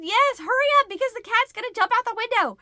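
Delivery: high, loud hurried plea; urgent shout. Retold or imitated. Wife hurrying her husband upstairs, high loud urging. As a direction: push him to move, fast and loud.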